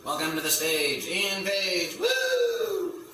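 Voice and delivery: mock MC hyping his own name, woo shot way up; self-announcing comic with a cheer; host announcer. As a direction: introduce yourself like an emcee. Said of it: "announcer intro".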